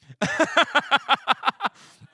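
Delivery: loud, high burst of staccato laughter; hearty laugh. Real, not acted. Laughs at joke about age.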